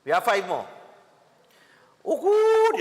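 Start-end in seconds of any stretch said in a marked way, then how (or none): 2.0-2.8 sudden high squeal of Oh, good; delighted surprise. Real, not acted. Host squeals with delight at the good news. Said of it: "You see what I tell you?".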